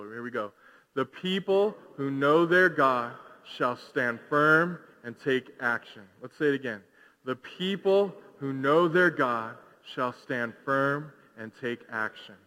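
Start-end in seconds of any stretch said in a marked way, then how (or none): 0.9-6.1 slow, deliberate phrases for a crowd to follow; measured communal chant-like reading; leading group recitation. Real, not acted. Leads congregation reciting verse. 7.2-12.4 slow, deliberate phrases for a crowd to follow; measured communal chant-like reading; leading group recitation. Real, not acted. Leads congregation reciting verse.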